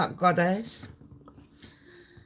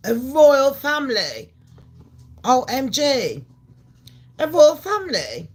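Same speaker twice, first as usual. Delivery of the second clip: higher, louder shocked mockery of gossipers; 5 semitones higher, 5 dB louder than usual. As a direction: play the gasping crowd, hammed-up. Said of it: "And they're like".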